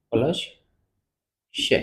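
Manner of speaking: usual speaking voice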